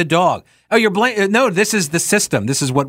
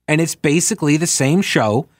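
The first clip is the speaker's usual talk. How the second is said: ordinary talk, nothing marked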